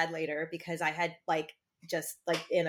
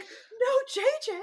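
very high, loud mock toddler wail; toddler shriek; 4 dB louder than usual. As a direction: shriek it like a toddler losing her show. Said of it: "she's just shrieking".

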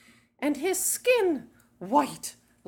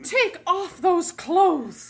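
stern command; 10 semitones higher, 5 dB louder, slower than usual. Acted. Aunt commanding the girl.

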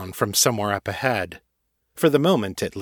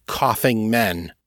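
usual speaking voice

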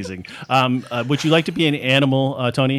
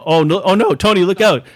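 hammed-up panic, louder; mock alarm; 5 semitones higher, 5 dB louder than usual. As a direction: shout a fake warning. Fake alarm at gunshots.

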